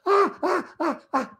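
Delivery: very high frantic yelps; hammed-up electrocution shriek; mock electrocution scream. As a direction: scream like you are being shocked.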